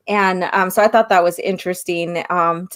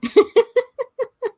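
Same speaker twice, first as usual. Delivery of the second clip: high laugh. Real, not acted. Laughs at possessive joke.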